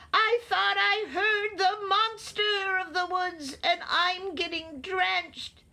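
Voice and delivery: high-pitched anxious rabbit voice; crying rabbit. A narrator's reading. Rabbit drenched, pleading with Owl, crying out high. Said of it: "cried Rabbit".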